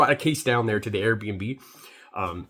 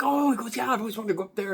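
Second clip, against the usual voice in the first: lightly voiced bartender, higher and chirpy; high friendly bartender; 11 semitones higher than usual. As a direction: play the eager bartender lightly, pitched up. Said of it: "He's like".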